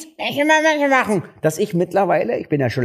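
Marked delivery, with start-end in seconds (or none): 0.0-1.2 high, loud mock girlfriend voice calling from afar; a hammed-up partner calling out, much higher; mimicking girlfriend. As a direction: play the girlfriend shouting from another room. Girlfriend voice again.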